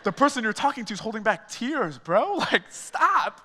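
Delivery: high, pointed friend voice through laughs; friend's blunt hidden meaning, lightly played; imitating blunt friend. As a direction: voice the friend calling him out, laughing. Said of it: "his way of saying".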